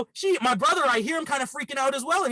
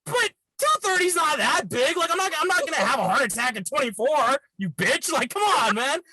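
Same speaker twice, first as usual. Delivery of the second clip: raised, high-pitched indignation; indignant rant; 6 semitones higher than usual. Real, not acted. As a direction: get worked up and defensive. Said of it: "I was pretty mad".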